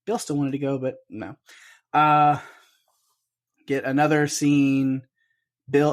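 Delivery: ordinary delivery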